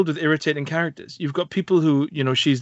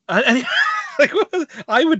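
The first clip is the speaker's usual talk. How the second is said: high laughing outburst; laughing shout; 12 semitones higher, 4 dB louder than usual. Real, not acted. Telling a friend the story, laughing shout. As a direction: burst out laughing mid-story.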